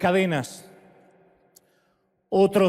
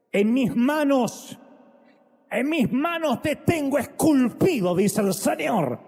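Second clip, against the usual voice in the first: slow, rising, cried-out promise; impassioned voice of Christ; Christ speaking with fervor; 5 semitones higher, slower than usual. Acted. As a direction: proclaim it as Christ's own vow. Voicing Christ, then worship.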